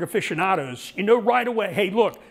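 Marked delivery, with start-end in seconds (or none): none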